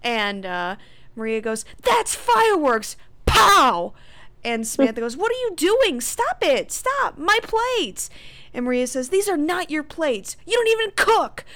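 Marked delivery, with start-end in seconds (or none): 1.8-3.9 loud, high, drawn-out acted outburst; explosive yell. Maria smashing Samantha's plates, explosive yell. 5.2-8.1 high, loud acted wail; frantic protest. Samantha begging Maria to stop breaking plates, frantic protest. 9.1-11.4 loud, high acted comeback; angry retort. Maria answering Samantha over the plates, angry retort. Maria shouts back in quarrel.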